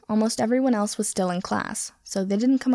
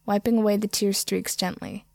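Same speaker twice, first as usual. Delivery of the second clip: ordinary delivery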